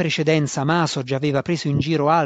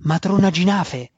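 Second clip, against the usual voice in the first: breathless excitement; 4 semitones higher, 6 dB louder than usual. A narrator's reading. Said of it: "ripeté Alton ansimante".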